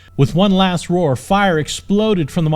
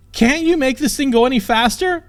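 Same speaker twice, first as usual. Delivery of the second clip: much higher, louder pleading; urgent demand; 10 semitones higher, 3 dB louder than usual. Acted. Princess urging the knight mid-chase, high urgent demand. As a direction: push him to go faster.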